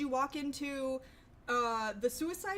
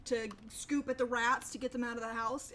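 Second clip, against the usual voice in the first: ordinary talk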